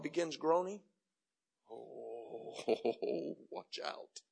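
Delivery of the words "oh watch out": normal talking voice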